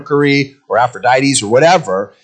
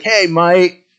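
higher Australian voice assistant greeting; mock Australian-accented Siri, pitched up; 4 semitones higher than usual. Pastor imitates Siri greeting its user, Australian accent. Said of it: "with an Australian accent for Siri".

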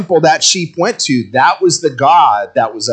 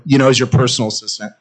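usual speaking voice

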